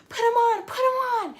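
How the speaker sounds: loud high mock yell of the nurse; imitating a yelling nurse. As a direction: belt it out like a frantic nurse. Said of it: "yelling at her".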